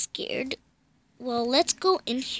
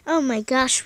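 usual speaking voice

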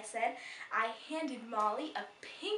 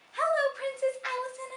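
excited greeting; 8 dB louder, faster than usual. Acted.

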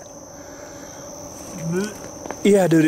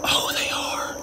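whispering; 7 dB quieter, slower than usual. Real, not acted. Staring at creepy doll's eyes.

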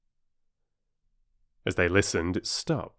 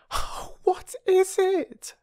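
higher, slower pleading question; more frightened girl; slower than usual. A narrator's reading. Little girl begging to know, higher and frightened. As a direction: ask again, more scared. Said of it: "said Phyllis".

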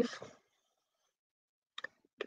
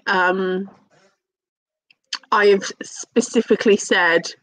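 usual speaking voice

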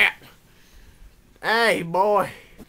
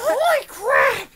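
shout; 9 dB louder than usual. Acted. Woody drunk outburst.

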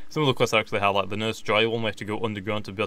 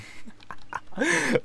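sudden high-pitched giggle; squeaky burst of laughter; 3 dB quieter than usual. Real, not acted. Headless sausage Pokemon on screen.

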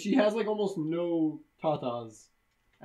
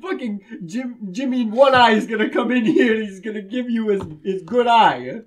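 loud, higher-pitched, hammed-up mafia old man; mock mobster threat; 6 semitones higher, 9 dB louder than usual. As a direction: play a blustering mobster through suppressed laughter.

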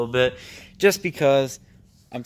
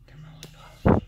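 tense hushed whisper; 5 dB louder than usual. Real, not acted. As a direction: whisper so nothing hears. Hiding after hearing something.

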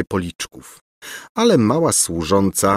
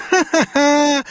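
very high, villainous he-he; mocking cackle; slower than usual. A narrator's reading. Governess jeering after the prince, mocking cackle. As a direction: let out a gloating, high-pitched cackle. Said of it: "skrzeczała za nim Gburja Furia".

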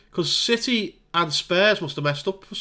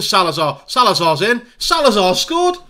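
rising to a high delighted shout as the goal goes in; real excitement at a surprise goal; excited goal call; 4 semitones higher, 7 dB louder than usual. Real, not acted. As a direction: call the goal with real joy, going up sharply. Goal scored in his game.

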